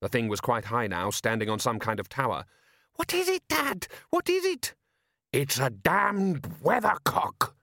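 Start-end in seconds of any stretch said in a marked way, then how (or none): 2.9-4.8 very high, squealed questions; boy in shrieky panic; panicked son. Acted. 5.3-7.6 high, drawn-out horrified cry; father's slow, raised-pitch outburst; alarmed exclamation. Acted.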